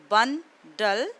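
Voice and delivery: बन, डल as two loud separate beats; split into syllables. Shown as a demonstration. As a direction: punch बन, pause, then डल. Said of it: "ये syllable complete हो गया".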